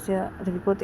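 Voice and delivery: usual speaking voice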